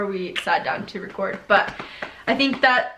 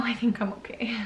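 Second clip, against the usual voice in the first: breathy laughing whisper; mother with her hands full, joking; 8 dB quieter than usual. Real, not acted.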